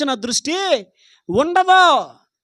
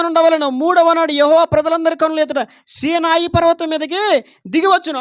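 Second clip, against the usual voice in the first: louder, ringing scripture reading; proclaiming scripture; 3 semitones higher, 6 dB louder than usual. Real, not acted. Preacher proclaiming God's words to Moses, loud. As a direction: declare God's word to the crowd.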